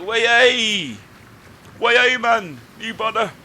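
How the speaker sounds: loud, high made-up northern-style accent, hammed up; really bad accent. Gamer voicing the ship's rude captain in a made-up accent. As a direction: bellow it in a made-up accent nobody's heard of. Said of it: "what accent was that?".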